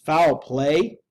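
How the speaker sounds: loud, startled question; loud, rising, frightened lawyer; alarmed cry. A narrator's reading. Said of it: "cried the lawyer".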